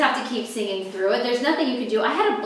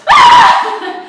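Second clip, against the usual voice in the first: huge high scream; really loud scream; 12 dB louder than usual. Retold or imitated. Waitress screaming at a roach on her hand. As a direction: shriek as the roach runs over your hand. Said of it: "Really, really loud".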